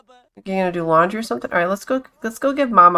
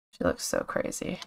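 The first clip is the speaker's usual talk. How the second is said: breathy whispered aside, much softer; amused whisper; 13 dB quieter than usual. Real, not acted. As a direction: whisper it as if to yourself. Muttered reaction to the Sim.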